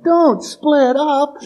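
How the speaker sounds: high, slow, drawn-out mock warning cry; mock yell at TV. Viewer warning movie characters, hammed-up high yell. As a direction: wail it at the screen in exaggerated alarm.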